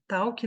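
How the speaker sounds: "talk" said as Portuguese "tau-ki"; "talk" in two syllables, "TAU-kee"; learner's mispronunciation. Shown as a demonstration. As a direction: sound the L as U and add a final i: "tau-ki" for "talk". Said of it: "não existe esse som no inglês".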